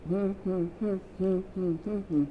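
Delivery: short closed-mouth hums in a row; duck talk hum. Shown as a demonstration. Teacher showing each syllable's vowel, hummed with mouth closed. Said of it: "say it with my mouth closed".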